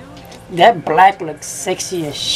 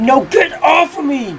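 angry shout; 5 semitones higher than usual. Real, not acted. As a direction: shout higher at the car ramming you. Rammed by cop car.

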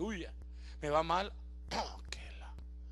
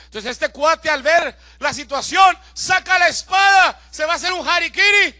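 louder, building storytelling; dramatic preaching; 7 semitones higher, 14 dB louder than usual. Acted. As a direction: preach the jailer's despair with force. Narración dramática del carcelero. (predicación dramática en voz alta)

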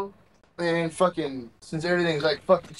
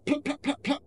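imitated gunshots; 11 semitones higher than usual. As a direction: mimic rounds hitting the car. Imitating rifle shots.

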